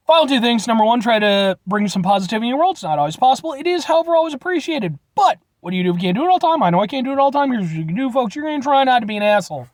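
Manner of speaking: ordinary delivery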